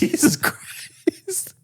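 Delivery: high, slow, airy laughing exclamation; breathless laugh. Real, not acted. Cohost cracking up at his friend's crude joke, airy laughter. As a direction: wheeze it out through laughter.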